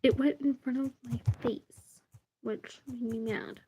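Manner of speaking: a hushed annoyed whisper to herself. Real, not acted. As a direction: breathe it out as a private grumble. Repeats the complaint under breath.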